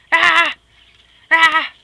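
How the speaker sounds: high, loud mock squawk; a sharp playful bird cry. Voicing the bird squawking at the other bird, loud.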